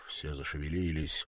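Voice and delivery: ordinary delivery, nothing marked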